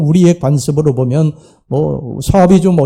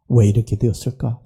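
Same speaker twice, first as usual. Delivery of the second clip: softer, lower musing to himself; quiet self-questioning; 5 semitones lower, 5 dB quieter than usual. Acted. Prodigal son asking himself why, quietly.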